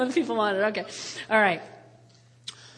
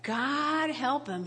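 slow pitying prayer; 8 dB quieter, much slower than usual. Retold or imitated. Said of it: "And I'm thinking".

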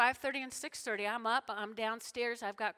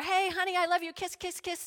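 high, loud breezy hello in passing; rushed greeting; 9 semitones higher, 9 dB louder than usual. Acted. Spouse running in the door past honey, rushed greeting. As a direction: call it out high and loud on the run.

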